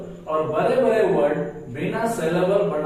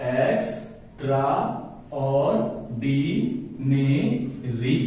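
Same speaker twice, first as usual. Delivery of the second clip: slow "extraordinary", a short gap after each syllable; easy syllabic reading; 2 semitones lower, much slower than usual. Shown as a demonstration. Teacher showing how easily it reads, syllable by syllable. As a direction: read "extraordinary" in six unhurried beats. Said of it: "कितना आराम से हम लोग पढ़ लेंगे".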